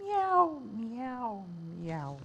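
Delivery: high meow sinking and fading; fading kitten meows. Acted. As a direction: mew then trail off softly. Kittens meowing in poem.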